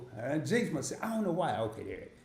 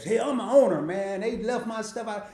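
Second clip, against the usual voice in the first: louder, higher mock call as a self-important owner; mock entitled complaint; 6 semitones higher, 5 dB louder than usual. New shareholder calling DoorDash to complain, loud and mocking. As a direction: ham up a customer griping that he owns the place. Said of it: "don't call DoorDash".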